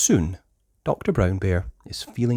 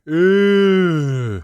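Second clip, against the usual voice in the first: disgusted eh; 9 semitones higher, 6 dB louder than usual. Acted. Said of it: "cries Pedro".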